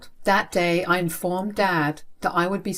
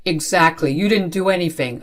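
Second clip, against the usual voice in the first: stern retort; 3 dB louder than usual. Acted. Father to protesting child, stern retort. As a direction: turn their words against them.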